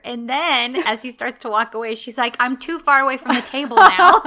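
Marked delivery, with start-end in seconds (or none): 2.4-4.2 put-on loud, higher-pitched kid's protest; playfully exaggerated whine; mock child complaint. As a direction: complain like a demanding little girl. Child complaining in retold scene.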